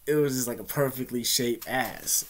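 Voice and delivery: ordinary talk, nothing marked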